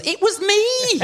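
high, laughing call from the crowd; mum calling out. Retold or imitated. Mum calls out from audience at press night, high laughing shout. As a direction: blurt it out like a proud mum. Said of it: "my mum goes".

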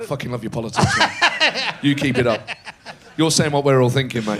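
hammed-up bloke backslapping, mate; mock laddish praise. Teammates mockingly praising his politics, laddish laughing voice.

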